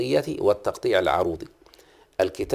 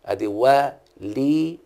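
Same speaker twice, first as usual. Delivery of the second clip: وليد said very slowly, each syllable apart; slow syllable demo; 2 semitones higher, much slower than usual. Shown as a demonstration. Teacher scanning a student's name very slowly. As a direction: stretch وليد out beat by beat. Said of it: "فلما اقول مثلًا وليد".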